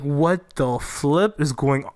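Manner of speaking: normal talking voice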